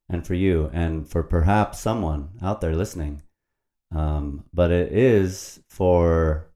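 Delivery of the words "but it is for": slow solemn emphasis. Real, not acted.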